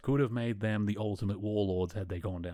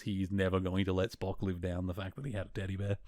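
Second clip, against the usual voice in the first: usual speaking voice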